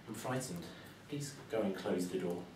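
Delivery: a mother hushed with fright; frightened mother. Acted.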